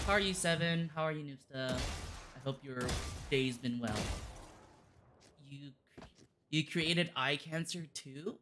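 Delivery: ordinary delivery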